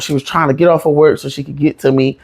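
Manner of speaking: normal talking voice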